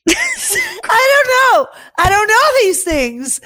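high, laughing protest; laughing hard. Real, not acted. Answering her disgusted friend, laughing high. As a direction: squeal it out through laughter.

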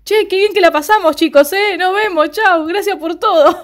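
high, loud, bubbly farewell; Villanelle leaving the scared coworkers, fake cheerful; sudden cheerful goodbye. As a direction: switch instantly to breezy cheer. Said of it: "Y de repente".

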